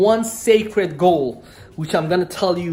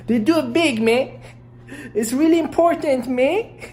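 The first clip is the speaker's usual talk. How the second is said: high-pitched, hammed-up Toronto gangster accent; put-on Toronto accent; 10 semitones higher than usual. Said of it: "This accent, man, is unique for me".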